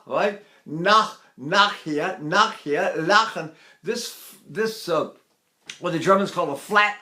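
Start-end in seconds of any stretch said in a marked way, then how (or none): 0.7-3.5 "nachher" with German ch; German words. Shown as a demonstration. Teacher modelling German vowels. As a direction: say "lachen" with a throaty German ch. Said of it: "So if I say".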